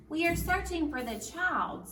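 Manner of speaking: a king gently questioning, voice lifted; second king. Acted.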